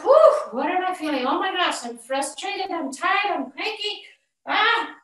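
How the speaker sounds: high, exasperated self-talk after a big exhale; frazzled mom. Acted. Stressed mother checking in with herself, frazzled.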